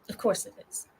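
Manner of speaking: whispered aside. Real, not acted. Streamer muttering resigned aside about the private link.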